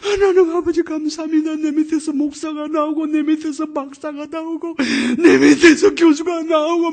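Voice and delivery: high, loud, tearful thanks to God; crying prayer. Retold or imitated. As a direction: pray through tears. Teacher's tearful prayer voiced. (높고 크게 울먹이며 기도)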